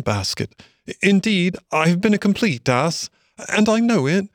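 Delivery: high, plaintive Mole voice; emotional apology. A narrator's reading. Dejected Mole to Rat in the boat, high emotional apology. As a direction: plead earnestly for forgiveness, pitched high. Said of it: "he said in a low voice, broken with emotion".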